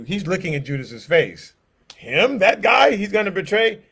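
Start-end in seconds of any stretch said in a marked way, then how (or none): 2.0-3.8 higher and louder, stunned questions; shocked disbelief. Acted. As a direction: gasp it out, can't believe it's him. John reacting in shock.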